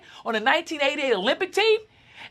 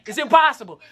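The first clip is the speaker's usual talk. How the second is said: ordinary delivery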